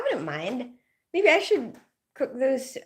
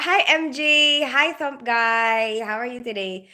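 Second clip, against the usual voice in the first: normal talking voice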